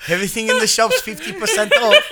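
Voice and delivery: high, loud shopkeeper pitch; hammy shop sales call; hearty innkeeper. Pretend. Joining innkeeper shop bit.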